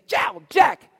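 loud, high mock cries of being hit; hammed-up fake protest, shouted; sister faking pain. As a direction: imitate a sibling yelping for a parent to hear. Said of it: "slap herself and say".

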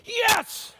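high, loud victory yell; triumphant shout. Shown as a demonstration. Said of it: "We shout with a triumph".

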